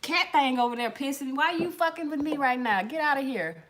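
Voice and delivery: hammed-up cussing at the cat; scolding the cat. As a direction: tell the cat Desiree off. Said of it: "I was like".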